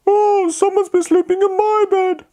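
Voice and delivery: high Mummy Bear voice. Acted. Mummy Bear's line.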